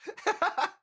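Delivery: very high giggle burst; shrill happy laugh; high squeal of laughter. Real, not acted. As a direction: squeal with delight. Laughing at chat.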